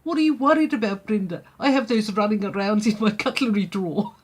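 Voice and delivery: a light attempt at a South African accent, breezy. South African friend unbothered by the cockroach, in a loosely done South African accent. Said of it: "I can't do a South African accent, but I'm gonna try".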